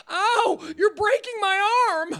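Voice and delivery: high, loud, slow whine; whiny photographer. Retold or imitated. Host mocks the photographer's high whining complaint. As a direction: overplay the pain like a whimpering victim. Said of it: "his response".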